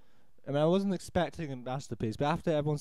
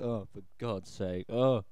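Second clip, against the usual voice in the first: exasperated groan; 6 semitones lower, slower than usual. Retold or imitated. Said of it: "And I'm just like".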